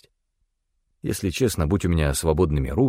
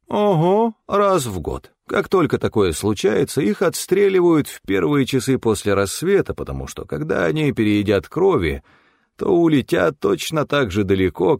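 a touch higher, animated lecturing tone; lightly voiced Stefan; 6 semitones higher than usual. A narrator's reading. Stefan lectures Til on the wild Zavyu, animated and higher. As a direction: lightly voice an animated, know-it-all companion.

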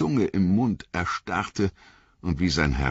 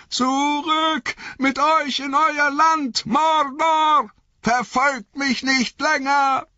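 very high, slow, desperate command; thin, shrill shout; 4 dB louder, slower than usual. A narrator's reading. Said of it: "wie dünn und schrill seine Stimme klang". (dünn und schrill gerufen)

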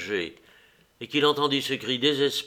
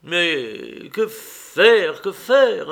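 slow, higher despairing cry; desolate; 7 semitones higher, 9 dB louder, much slower than usual. Acted. Husband at a loss before his wife, desolate cry. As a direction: wail it helplessly, lost. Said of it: "d'une voix désolée".

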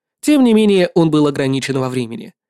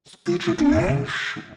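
slow, soft demonic voice with delight; demon lord, impressed; 6 dB quieter, much slower than usual. Acted. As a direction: savour the word like an amused demon. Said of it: "с намёком на восторг в голосе".

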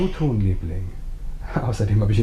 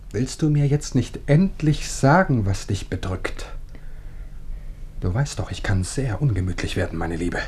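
quiet menace; 2 semitones lower, 3 dB quieter than usual. Acted. Husband quietly threatens wife. (leise Drohung)

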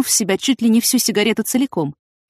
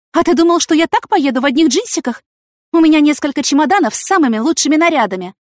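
high, loud, incredulous woman; indignant heroine; 9 semitones higher, 6 dB louder than usual. A narrator's reading. Yana telling the investigator about her suitcases, indignant. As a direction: explain it as if it were obvious.

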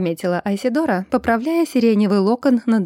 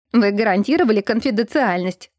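ordinary delivery, nothing marked

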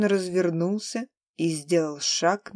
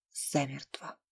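whispered; 5 semitones lower, 12 dB quieter than usual. A narrator's reading. Narrator ends death line.

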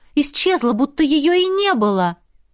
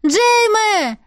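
high calling shout; 10 semitones higher, 3 dB louder than usual. A narrator's reading. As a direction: call out to him across the mountain. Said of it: "закричала я". (громкий высокий крик)

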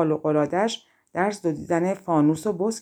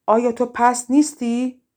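higher, louder indignant question; witch's raised-voice challenge; shouted accusation; 5 semitones higher, 5 dB louder than usual. A narrator's reading. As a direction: call out the reproach from afar. Witch shouts accusation across lake.

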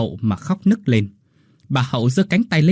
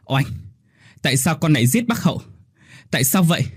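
higher-pitched anguished question; distraught cry; 5 semitones higher than usual. A narrator's reading. Thuy holding the dying Hau, crying out at Oanh. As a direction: cry out in grief and disbelief. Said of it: "mà hét lên".

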